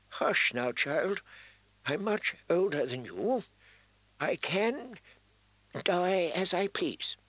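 put-on halting dying old man, pitch climbing; weak, dying voice. A narrator's reading. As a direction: soothe the child with failing breath. Dying maester hushes the child.

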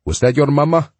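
put-on gentle question in a Spanish accent. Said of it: "with a heavy Spanish accent".

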